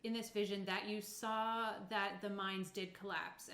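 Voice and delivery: normal talking voice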